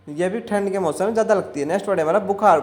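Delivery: usual speaking voice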